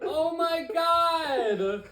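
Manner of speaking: high, long drawn-out cry of dismay; alarmed wail. Real, not acted. Host as the drink spills over, high drawn-out alarm. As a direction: stretch it into a comic wail.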